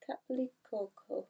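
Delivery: a slow unsure whisper of the name Kaplikoko; whispered retry. Shown as a demonstration. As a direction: whisper Kaplikoko slowly to yourself, testing the sound. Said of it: "I don't know how to pronounce that".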